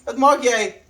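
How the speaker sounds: mock-stern high voice to the dog; Afrikaans pet talk. Real, not acted.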